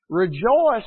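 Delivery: loud ringing REJOICE; proclaimed. Acted. Preacher proclaiming Paul's command to the church, loud. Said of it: "But he says".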